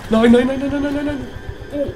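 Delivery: screaming no in real panic; panicked no. Real, not acted. Player watching disaster, high desperate noes. Things going wrong.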